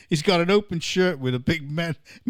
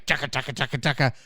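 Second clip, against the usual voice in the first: low, soft nonsense patter; mock Uber driver patter; 5 semitones lower, 5 dB quieter, faster than usual. Host mimicking an Uber driver's babble, low mock patter. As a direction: rattle off mock foreign gibberish, dropped low. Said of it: "Peter actually became an Uber driver".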